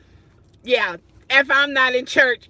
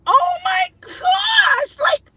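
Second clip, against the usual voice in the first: high, slow, loud wail with a crying edge; real embarrassed cry-shout; mortified wail; 4 dB louder, slower than usual. Real, not acted. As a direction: wail like you just got caught. Realizes the typo in her title.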